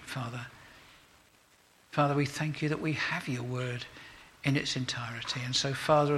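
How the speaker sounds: soft, earnest prayer voice; gentle, reverent closing prayer; pastoral prayer. Acted. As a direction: pray humbly over the congregation. Leads a prayer.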